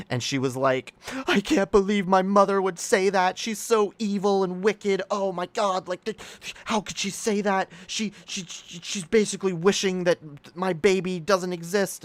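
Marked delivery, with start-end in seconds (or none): 1.3-12.0 imitating an upset mom, high-pitched mock wail; hammed-up crying outrage in a higher voice; tearful, angry mother. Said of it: "she started to cry and get angry".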